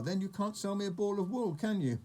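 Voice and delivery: high, quick, huffy challenge; indignant reproach. A narrator's reading. Old sheep giving up on shopkeeper, quick indignation. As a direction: rattle it off as a pointed reproach.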